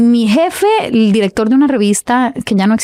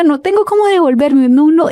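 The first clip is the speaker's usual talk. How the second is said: retold crying plea; 6 semitones higher than usual. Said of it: "empiezo a llorar".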